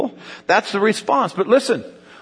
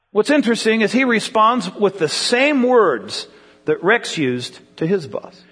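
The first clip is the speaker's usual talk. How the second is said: ordinary talk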